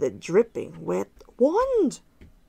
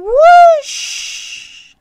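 excited whoosh; 15 dB louder than usual. A narrator's reading. Broom takes off.

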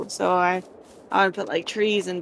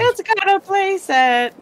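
delighted; 10 semitones higher, 4 dB louder, slower than usual. Real, not acted. Delighted by the house.

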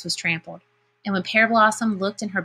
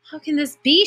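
high, louder demanding question; 8 semitones higher, 5 dB louder than usual. A narrator's reading. Stepmother sees the polished rice, demanding. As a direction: demand an explanation, pitched well up. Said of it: "she demanded".

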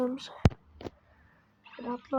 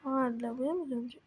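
ordinary delivery

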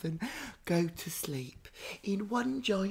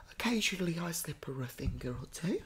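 whisper; 3 semitones lower, 6 dB quieter, faster than usual. Real, not acted. Whispered rude aside.

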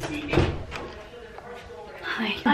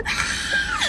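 high piercing yelp of frustration; frustrated scream. Retold or imitated. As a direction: let out a sudden shriek. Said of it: "and I said".

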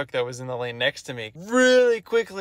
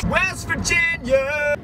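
excited shout; 11 semitones higher, 6 dB louder, slower than usual. Real, not acted. Arriving, calling out state name.

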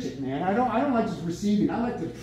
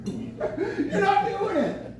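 laughing tease; 8 semitones higher, much slower than usual. Real, not acted. Teasing listeners, laughing.

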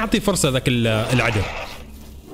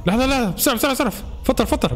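panicked high urging; 10 semitones higher than usual. Real, not acted. Near death, rushing for healing.

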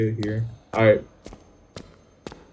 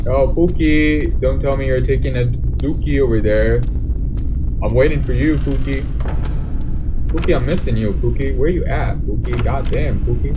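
calling out; 3 semitones higher, 3 dB louder than usual. Pretend. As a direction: sing out for him like a lost pet. Calling out to a hiding character.

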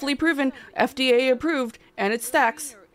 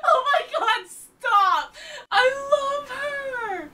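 high, slow swoon; 9 semitones higher, much slower than usual. Real, not acted. Smitten after the streamer texted back. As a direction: stretch it out dreamily, way up high.

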